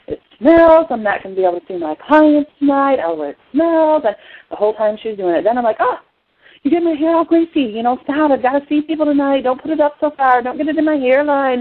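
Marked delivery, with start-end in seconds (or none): 0.1-4.0 whiny, higher and louder griping; complaining. Retold or imitated. Patient griping to the helper about the oil's smell, complaining. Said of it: "I complained, number one, about the smell". 5.8-11.6 higher-pitched nagging complaint; griping. Retold or imitated. Patient to the helper putting oils near the hair, griping. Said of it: "And griped about that".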